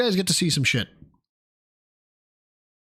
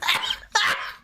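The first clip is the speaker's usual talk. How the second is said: high breathless laugh. Real, not acted. Laughs at clip again.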